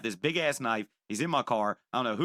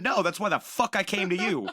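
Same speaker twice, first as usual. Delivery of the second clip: higher and louder, fed-up snap; exasperated retort; 8 semitones higher, 4 dB louder than usual. Retold or imitated. Scared buyer snapping at the drug expert, exasperated and loud. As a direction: throw it back at him, incredulous.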